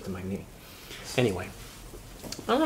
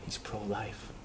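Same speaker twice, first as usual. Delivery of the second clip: whispered, much softer; hushed aside; 4 semitones lower, 19 dB quieter than usual. Acted. Confiding the twist to his friend, whispered. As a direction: lean in and whisper it.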